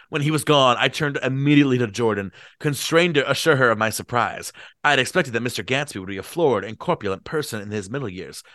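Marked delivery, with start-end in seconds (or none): none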